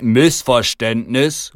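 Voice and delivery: loud, drawn-out indignant echo; outraged exclamation. A narrator's reading. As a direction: repeat it in indignant disbelief. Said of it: "rufe ich empört".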